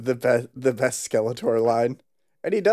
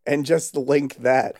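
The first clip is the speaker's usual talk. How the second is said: ordinary talk, nothing marked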